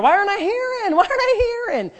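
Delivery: high-pitched mock wail; mock complaint. Acting out a believer who can't hear God, mock complaint. Voicing a frustrated listener's complaint.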